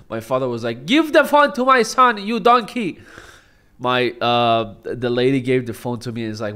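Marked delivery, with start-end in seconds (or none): 0.9-3.0 high, loud barking order; mock-furious Iranian dad; angry dad. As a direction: snap at the stranger like an impatient father. Dad snaps at the lady.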